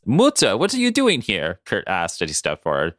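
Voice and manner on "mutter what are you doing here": a touch of German, high and surprised; German accent. Acted. Kurt greets his mother, in a light German accent. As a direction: say the German word the German way. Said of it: "Sorry, I had to do the German part".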